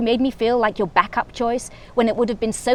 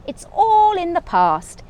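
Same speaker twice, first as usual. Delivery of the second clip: smiling; 10 semitones higher, 5 dB louder than usual. Acted. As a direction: float it out with a knowing smile. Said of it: "she smiled".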